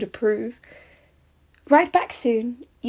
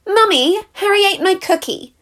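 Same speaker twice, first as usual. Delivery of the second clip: put-on higher, louder whiny kid voice; tattling child; 9 semitones higher, 5 dB louder than usual. Little sibling telling mummy on Harry, whiny.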